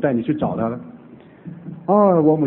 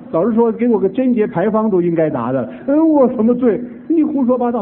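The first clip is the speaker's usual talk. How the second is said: higher, louder outraged protest; indignant retold scolding; 8 semitones higher, 3 dB louder than usual. Old mother berating the pastor, indignant shout. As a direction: throw the accusation back, offended.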